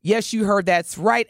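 ordinary talk

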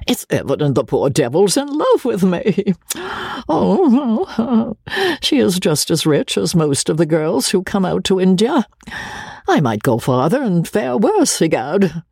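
conceited bachelor pleased with himself; self-satisfied Joseph. Acted. Joseph's self-satisfied thoughts voiced.

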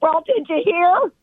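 very high, drawn-out mock outrage; imitated mad mom. Mom upset about her name Karen, imitated high indignation. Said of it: "She's so mad".

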